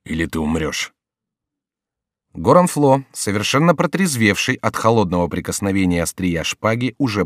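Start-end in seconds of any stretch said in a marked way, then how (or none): 0.0-0.9 lower, hollow-voiced death threat; muffled menace. A narrator's reading. Advocate warning the monk he will die, muffled menace. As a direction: threaten in a dull, muted voice. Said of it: "сказал глухим голосом адвокат".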